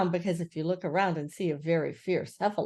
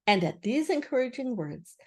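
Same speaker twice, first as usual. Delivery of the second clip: ordinary delivery, nothing marked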